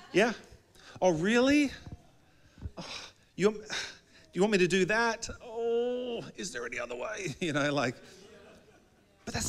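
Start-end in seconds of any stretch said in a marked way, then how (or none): 1.0-7.3 high, mock-pained pleading; reluctant whine. Believer begging Jesus for a way out, whiny reluctance. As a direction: whine your way out of it, higher pitched.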